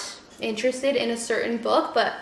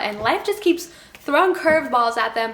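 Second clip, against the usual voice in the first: usual speaking voice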